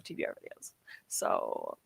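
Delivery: whispered confession to chat. Real, not acted. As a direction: breathe it out as a secret. Fond confession to stream chat.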